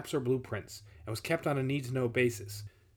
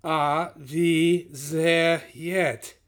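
put-on very slow, louder, out-of-breath German accent; winded wheeze; 4 semitones higher, 6 dB louder, much slower than usual. A narrator's reading. Exhausted Nazi repeating his question to the guide, wheezing. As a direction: drag out each word, wheezing with effort. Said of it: "the winded Blau wheezed".

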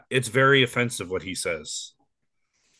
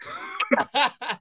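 high laugh; 13 semitones higher than usual. Real, not acted. Laughs at own punchline.

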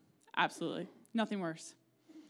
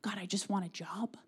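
whispered mock prayer; sheepish whispered plea to God; 3 semitones lower, 6 dB quieter than usual. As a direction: hush it like a secret prayer. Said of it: "of, like".

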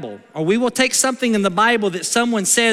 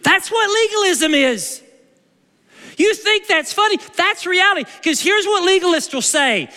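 higher, forceful preaching; 8 semitones higher than usual. Acted. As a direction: drive the point home. Preaching point after skit.

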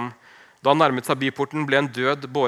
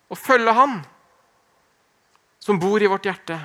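raised, insistent preaching voice; fervent preaching; 6 semitones higher than usual. Acted. Preacher urging the congregation to choose Jesus, fervent. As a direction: preach it out with conviction.

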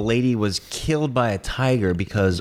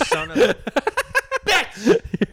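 laughing curse; much slower than usual. Real, not acted.

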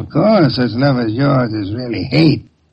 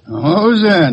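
usual speaking voice

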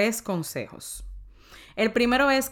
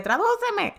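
much higher pleading "Tradúceme"; sister asking; 3 dB louder than usual. Retold or imitated. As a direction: mimic the sister, pitched way up, begging for a translation. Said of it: "mi hermana me decía". (hermana imitada, mucho más aguda, suplicando)

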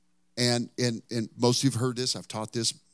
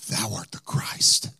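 weighty, measured outcry of revelation; retold cry of proclamation; 4 semitones lower, 5 dB louder than usual. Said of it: "he cried out".

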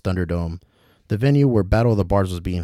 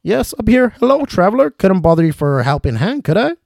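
put-on dangling old traveler; 12 semitones higher, 7 dB louder than usual. William calling to Ricky from upside down, high and fussy. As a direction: call down politely from the rope.

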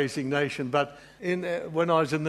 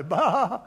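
a playful "baa" like a sheep, higher and louder; jokey sheep cry to the Lord; mock sheep bleat; 5 semitones higher, 4 dB louder than usual. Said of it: "go in the presence of the Lord and say".

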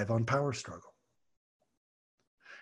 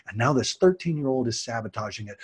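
usual speaking voice

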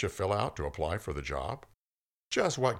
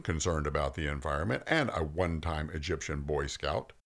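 ordinary talk

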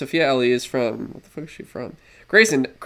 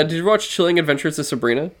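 usual speaking voice

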